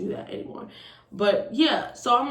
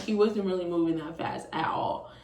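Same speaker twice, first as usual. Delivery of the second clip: ordinary talk, nothing marked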